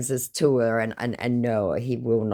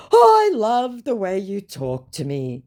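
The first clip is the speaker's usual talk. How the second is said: slow, higher syrupy flattery; oily, drawn-out charm; smarmy scammer; 8 semitones higher, 4 dB louder, slower than usual. Retold or imitated. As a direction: scammer trying to woo her, slow and sugary. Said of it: "he tries to turn the tide".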